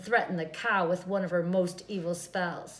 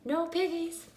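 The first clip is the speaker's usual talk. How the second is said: chicken voice; 8 semitones higher than usual. A narrator's reading. Chicken's reply.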